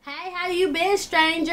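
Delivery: high, mock-sweet imitation of the ex's greeting; mocking his greeting. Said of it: "he was all in my D M talking about some".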